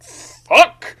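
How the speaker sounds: high, loud burst of swearing; upset outburst. A narrator's reading. Upset Hill under a constant glare, shouting. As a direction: blurt it out in a shout.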